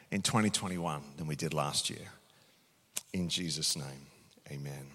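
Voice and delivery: quiet prayer. Acted. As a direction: pray softly and let it fade. Closing prayer.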